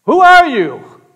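high, loud shouted question; shouting up. Acted. Hanging man demands to know who answers, shouting. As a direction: call it up out of a canyon.

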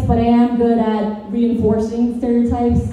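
ordinary delivery

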